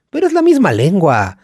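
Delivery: ordinary delivery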